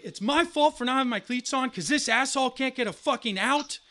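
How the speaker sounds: high, loud outraged argument; indignant retort. Retold or imitated. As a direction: argue back furiously. Arguing with coach.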